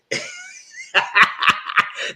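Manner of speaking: high, loud burst of laughter; laughing. Real, not acted. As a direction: crack up at your own story. Laughs at the retold mix-up.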